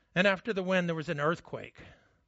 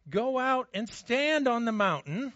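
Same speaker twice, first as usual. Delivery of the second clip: commanding God; 6 semitones higher, 5 dB louder than usual. Acted.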